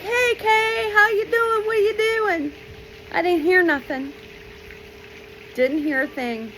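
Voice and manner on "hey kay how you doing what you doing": put-on very high, sing-song child voice; cheerful kid greeting. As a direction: call out brightly to a friend. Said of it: "I'm like".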